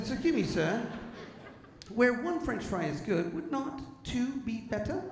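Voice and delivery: lightly voiced prim little bird, slow and pleading. Sparrow begging the comedian for fries, mock prim voice. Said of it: "He said".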